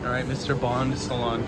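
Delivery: ordinary talk, nothing marked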